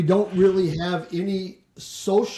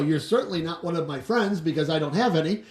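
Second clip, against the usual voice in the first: ordinary delivery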